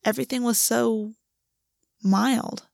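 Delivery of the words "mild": plain reading voice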